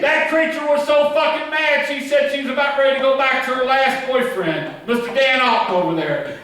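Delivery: high-pitched, riled-up storytelling; loud high comic tirade; worked-up rant. Acted. Riled-up bit after crowd call.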